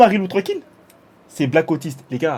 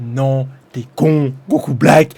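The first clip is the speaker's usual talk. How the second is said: drawn-out mock; 4 semitones lower, much slower than usual. Real, not acted. Mocking drawn-out insult.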